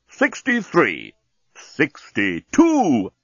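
slow robotic countdown voice; talking bomb. Acted. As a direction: count down slowly like a machine. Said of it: "said the bomb".

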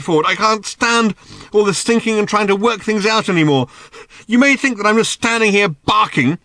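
very high, loud frantic tirade; ranting. A narrator's reading. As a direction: shout it, at the end of your tether. Arthur's furious rant at Ford.

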